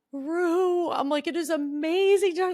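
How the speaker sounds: high, mock-tearful gushing; imitated welling up. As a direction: act out being starstruck and close to tears. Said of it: "I started to well up".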